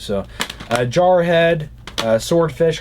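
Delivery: normal talking voice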